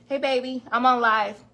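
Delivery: loud call to her partner; greeting her man, loud. Real, not acted. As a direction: call across the room. Greeting partner while live.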